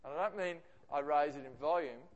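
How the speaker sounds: ordinary delivery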